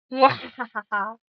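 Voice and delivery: playful mock evil cackle; evil laugh. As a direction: try out a villain's mwahaha. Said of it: "I will evil laugh at you back".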